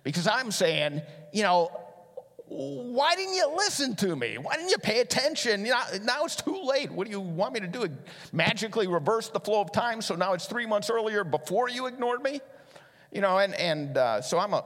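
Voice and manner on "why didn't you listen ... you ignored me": frustrated scolding. Retold or imitated. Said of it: "now I'm a little frustrated too".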